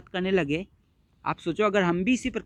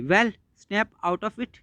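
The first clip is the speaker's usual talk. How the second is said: very slowly read, lightly brisk son; a hint of brisk impatience; briskly; 3 semitones higher, much slower than usual. Son telling mother to snap out of it, mildly brisk. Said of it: "briskly".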